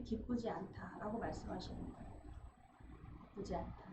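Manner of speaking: hushed, grave divine words; quiet voice of God. Retold or imitated. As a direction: speak softly as God's quiet rebuke. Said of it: "하나님이".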